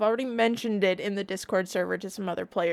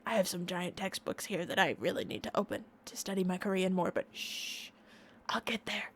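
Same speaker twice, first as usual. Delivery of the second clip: hushed aside; 9 dB quieter than usual. Real, not acted. Hushed secret aside, shh.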